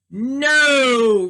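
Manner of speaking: loud, blunt No; a mean man's flat loud refusal; curt refusal. Acted. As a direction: slam the door on the request. Mister Mean refuses old man.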